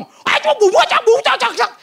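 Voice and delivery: high, loud imitation of Hokkien; mock Hokkien chatter. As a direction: bark out exaggerated Hokkien-sounding syllables. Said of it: "Itu Hokkien itu kalau ngomong". (menirukan Hokkien, nada tinggi keras)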